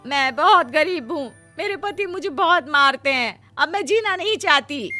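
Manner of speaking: high, whining put-on weeping; fake crying. Greedy sister-in-law lying to the fairy, fake crying. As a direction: plead with hammed-up misery. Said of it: "वो झूठ मूठ रोते हुए".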